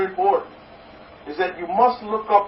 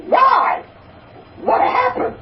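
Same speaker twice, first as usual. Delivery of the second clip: much higher, alarmed bartender question; puzzled and concerned; slower than usual. Acted. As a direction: shoot up in worried surprise. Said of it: "puzzled and concerned, asks".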